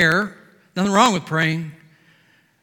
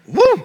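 high, loud joyful woo; a real preacher's cry of joy; exultant whoop; 12 dB louder than usual. Real, not acted. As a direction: whoop with delight.

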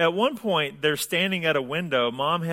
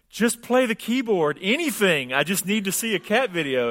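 high, louder begging voice; mock desperate plea; 6 semitones higher, 3 dB louder than usual. Man in the kitchen begging the cat, mock desperation. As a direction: plead comically, pitched well up.

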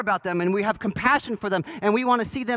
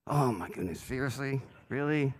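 eye-roll scoff; 8 semitones lower, 9 dB quieter than usual. Retold or imitated. Eye-rolling at a text.

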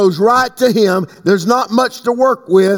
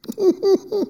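high, soft chuckle; 12 semitones higher, 5 dB quieter than usual. Real, not acted. Preacher amused by his own wine remark, chuckling. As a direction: let out a small high-pitched laugh.

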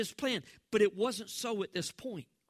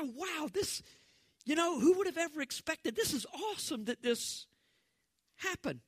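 amazed exclamation; 7 semitones higher than usual. Retold or imitated. Preacher acts amazed onlookers.

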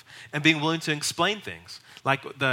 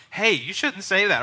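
higher, slow mock reprimand; scolding; 6 semitones higher, 4 dB louder than usual. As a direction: call them out like a scandalized churchgoer.

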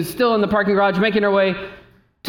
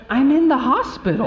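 incredulous; 6 semitones higher than usual. Retold or imitated. Baffled reply to the nurse.

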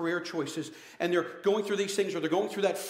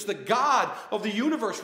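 normal talking voice

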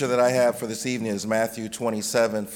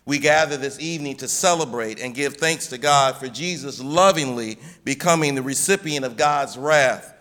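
normal talking voice

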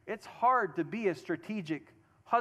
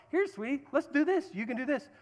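higher-pitched, encouraging husband voice; sweet coaxing; 5 semitones higher than usual. Acted. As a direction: coax your wife along sweetly. Models a husband gently guiding wife.

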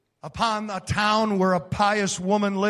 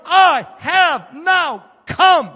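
slow, loud shout, much higher; commanding voice of the Lord's commander; 8 semitones higher, 7 dB louder, much slower than usual. Acted. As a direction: proclaim it like a heavenly commander.

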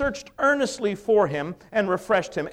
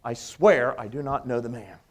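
sworn denial; 8 semitones lower than usual. Retold or imitated. Peter's third denial.

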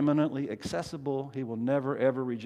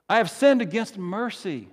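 raised-pitch confession of a sinner; mildly anguished, higher-pitched admission; fearful sinner; 5 semitones higher, 3 dB louder than usual. Acted. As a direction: protest as one afraid of rejection. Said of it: "the fears of the saints".

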